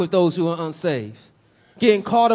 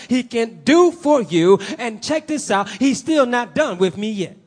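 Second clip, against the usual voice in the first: higher, emphatic pulpit voice; forceful preaching; 5 semitones higher, 5 dB louder than usual. Real, not acted. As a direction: preach it out with conviction.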